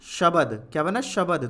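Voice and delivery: normal talking voice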